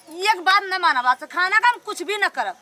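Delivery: ordinary talk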